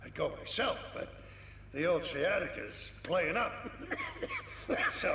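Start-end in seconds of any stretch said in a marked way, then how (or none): 3.6-5.0 rough hacking cough; a dry, wheezy old cough; old man's cough. Acted. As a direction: cough like a creaky old veteran. Old man complaining of ailments.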